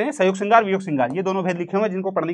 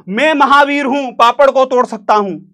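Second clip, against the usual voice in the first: mock boast; 4 semitones higher, 7 dB louder, slower than usual. Mock boast of a bragging friend.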